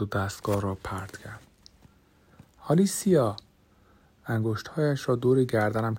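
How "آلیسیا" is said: sharp high call of her name, caught off guard; alarmed cry. A narrator's reading. Therapist crying out as his patient attacks him, startled high cry. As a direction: cry out her name as she lunges at you.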